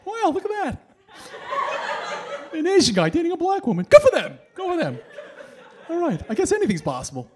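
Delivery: hammed-up wonder at an unlikely sight; amazed onlookers. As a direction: imitate astonished passers-by, pitched well up. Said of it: "it was always funny, their reaction".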